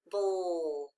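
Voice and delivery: "bull" drawn out low, falling; long, falling "bull", softly held on the l; isolated final syllable. Shown as a demonstration. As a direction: stretch "bull" as a lone syllable, pitch sinking. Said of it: "the final stable syllable".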